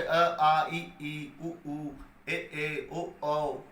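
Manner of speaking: short and long vowels listed one by one, अ, आ, इ, ई through औ; vowel sounds recited. Shown as a demonstration. Teacher giving students examples of vowel sounds, recited singly. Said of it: "ये इस तरह के जो sounds".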